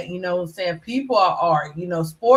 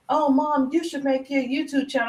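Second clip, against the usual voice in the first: daughter urging; 8 semitones higher than usual. Retold or imitated. Mom voicing her daughter's urging.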